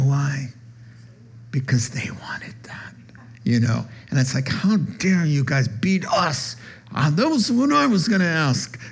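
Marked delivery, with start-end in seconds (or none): 1.5-2.9 whispered aside; hushed confiding whisper. Real, not acted. Confiding aside about disciples. 4.5-8.8 hammed-up offended disciples; mock indignant disciples.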